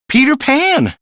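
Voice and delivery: high, loud delighted cry of his name; excited exclaim. A narrator's reading. Wendy surprised to see Peter, high excited cry. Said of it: "she exclaimed".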